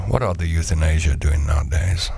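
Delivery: usual speaking voice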